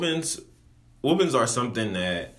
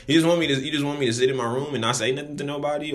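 fast, higher, louder outburst of disbelief; indignant rant; 7 semitones higher, 4 dB louder, faster than usual. Real, not acted. Son venting to viewers about strict mom, fast indignant. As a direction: rush it out, pitch up, fed up.

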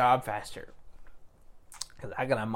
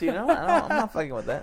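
laughing through the words; 7 semitones higher, 4 dB louder than usual. Real, not acted. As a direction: chuckle while you talk. Laughing at his own joke.

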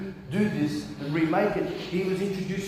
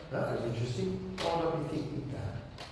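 sleepy musing; 12 dB quieter than usual. Retold or imitated. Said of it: "I just wake up and go".

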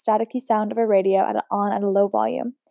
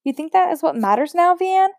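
higher-pitched indignant challenge; rising to a sharp, higher cry on the name; angry; 7 semitones higher than usual. Acted. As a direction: Isabel rounds on her sister, angry and higher. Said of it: "Isabel spat".

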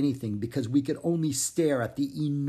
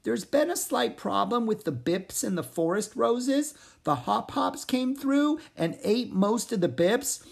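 higher frog voice; 8 semitones higher than usual. Acted. Pip explaining the problem to the hippogriff, in a higher voice. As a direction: politely explain the trouble.

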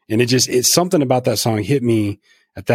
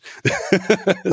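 high, breathy laugh at himself; embarrassed laugh; 9 semitones higher than usual. Real, not acted. Laughing at himself for crying to a country song, high embarrassed laugh. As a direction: laugh it off, a little sheepish.

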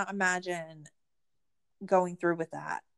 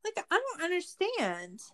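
exasperated disbelief; 10 semitones higher, 4 dB quieter than usual. Real, not acted.

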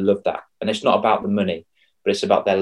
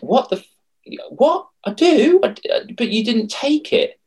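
exasperated outburst. Retold or imitated. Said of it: "I'm like".

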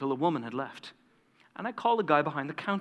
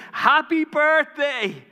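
slow, much higher, louder joyful yell; screamed; 11 semitones higher, 7 dB louder, much slower than usual. Retold or imitated. Crowd of prostitutes surprising Agnes at the door, screaming. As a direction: shout it out as a surprise. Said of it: "we all screamed".

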